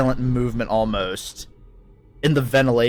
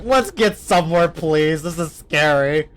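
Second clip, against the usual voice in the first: mock scared plea; 4 semitones higher than usual. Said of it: "It's like".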